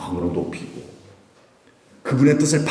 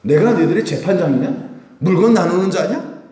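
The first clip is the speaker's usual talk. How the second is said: raised-pitch challenging questions; indignant rebuke; 5 semitones higher, 4 dB louder than usual. Retold or imitated. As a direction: throw it out as an angry rhetorical question. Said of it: "예수님도 화가 나시잖아요". (노여워 따져 묻는 말투)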